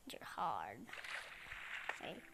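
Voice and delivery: soft whispered complaint; whispered grumble. Real, not acted. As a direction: breathe it, no voice. Whispered aside.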